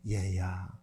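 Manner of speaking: low, tender grandfather calling a grandchild; grandfatherly call. Retold or imitated. Father calling his grumbling older son like a grandfather, tender low. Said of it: "할아버지가 손자한테 하는 그런 투예요".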